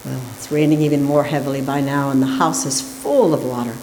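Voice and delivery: normal talking voice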